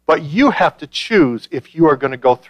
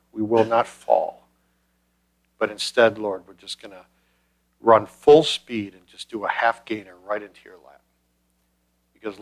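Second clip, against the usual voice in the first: soft, low-pitched prayer with long pauses; 4 semitones lower, 4 dB quieter than usual. Real, not acted. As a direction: lead a congregation gently through prayer. Leads congregation in prayer.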